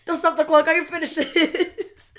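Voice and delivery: playing Monk on the floor begging on; laughing imitation of a desperate Monk; mock determined plea. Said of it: "he's like".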